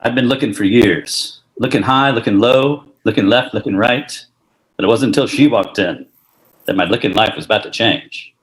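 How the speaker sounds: noir detective. Acted. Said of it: "as a film noir".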